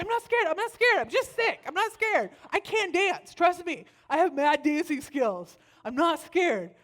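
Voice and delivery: loud, high-pitched comic denial; defensive protest. Retold or imitated. Sick babysitter denying fear to a child, defensive. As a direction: insist loudly, voice shooting up. Arguing back at little girl.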